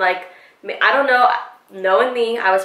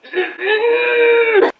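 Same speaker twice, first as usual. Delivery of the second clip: high, strained squeal of frustration; acted exasperated squeal; frustrated squeal; 13 semitones higher, 4 dB louder than usual. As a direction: let out a long high noise of exasperation. Retold frustration at his denials.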